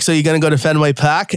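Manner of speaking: quick imitation of a Boston local asking; Boston accent. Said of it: "that's how they say it".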